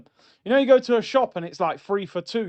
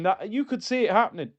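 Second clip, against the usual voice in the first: usual speaking voice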